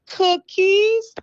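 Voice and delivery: high, stretched-out delight; delighted exclamation. Real, not acted. Host thanking someone for a treat, delighted. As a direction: sing out your delight, high and long. Delighted at being handed cookies.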